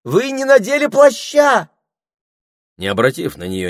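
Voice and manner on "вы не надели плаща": high, loud fussing call; the snake fretting loudly at the parrot. Acted. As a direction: call up after him like a fussing nanny. Snake still fussing after parrot.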